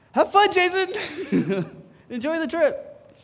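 high, slow, laughing mock farewell; laughing mock send-off to the friend who took his place; sarcastic laughing. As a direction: wave him off with a wry laugh.

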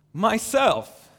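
high ringing joyful call. A narrator's reading.

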